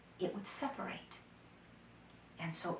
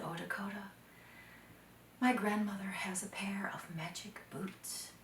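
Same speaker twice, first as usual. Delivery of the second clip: put-on girl whispering to her friend about the magic boots; hushed secret; 6 dB quieter than usual. Ihawi shares a secret fear.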